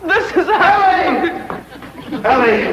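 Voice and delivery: very high, slow, giddy cry with a laugh; love-struck cry. Acted. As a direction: swoon out her name like a smitten suitor. Love-struck man announces Ellie.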